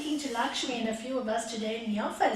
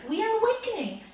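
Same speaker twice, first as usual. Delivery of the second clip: higher and louder we are awakening; preaching climax; 6 semitones higher, 6 dB louder than usual. Real, not acted. Preacher proclaiming awakening to the hall, high and loud. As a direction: lift it to a rousing peak.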